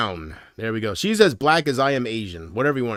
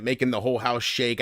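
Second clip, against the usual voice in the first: ordinary talk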